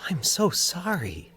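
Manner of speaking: soft whispered sympathy; hushed whisper. A narrator's reading. Valerie whispering condolences to Mike. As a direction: breathe it out gently, whispering. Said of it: "she said in hushed tones".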